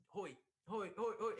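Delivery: high, loud repeated hail; imitated calling out. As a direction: imitate hailing someone through a crowd. Artist calls through the crowd.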